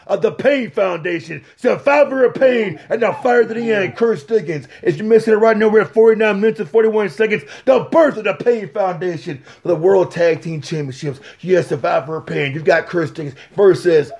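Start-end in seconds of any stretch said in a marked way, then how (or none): none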